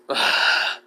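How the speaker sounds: heavy voiceless sigh; long settling exhale. Acted. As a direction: breathe out hard to calm down. Calming self after rant.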